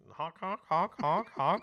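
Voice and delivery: loud honking air horn imitation; air horn honks. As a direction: honk like a crowd air horn. Mimicking crowd air horns.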